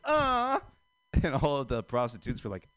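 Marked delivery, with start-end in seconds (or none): 0.0-0.6 high, held mocking "ah"; mock come-on noise. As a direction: let out a high, drawn mock moan. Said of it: "walk past the windows and go like".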